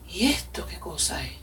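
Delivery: low, soft puzzled question; hushed wonder. Retold or imitated. Newcomer at the church door asks herself, hushed and puzzled. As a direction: wonder quietly to yourself.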